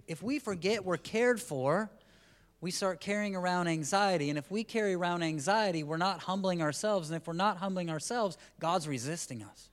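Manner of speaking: fervent sermon climax; preaching at full force. Acted. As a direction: drive the point home to the congregation. Preaching builds.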